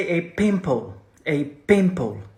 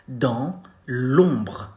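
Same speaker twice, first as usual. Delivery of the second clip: Dans l'ombre drawn out with a short, brief br at the end; slow teaching model; slow demo; 3 semitones lower than usual. Shown as a demonstration. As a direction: spell Dans l'ombre out slowly. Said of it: "You say".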